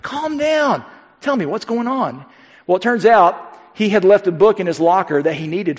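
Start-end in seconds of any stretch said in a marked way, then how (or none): 0.0-2.2 high sharp call to calm a friend; high urgent plea to calm down. Retold or imitated. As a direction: cut through his panic. Calling out to panicked friend.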